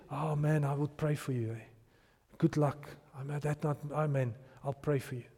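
low, soft throwaway well-wishing; half-hearted sympathy. Pretend. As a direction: mumble a polite brush-off. Imagined half-hearted passer-by offering prayer.

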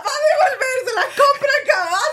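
exclamar muy agudo, muerta de risa; burla entre risas. Real, not acted. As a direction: chillido burlón y risueño. Teasing outburst about the resale business. (entre carcajadas, con voz muy aguda)

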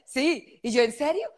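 high, stunned ¿en serio? asked twice; can't believe the offer, voice shooting up; amazed disbelief. Retold or imitated.

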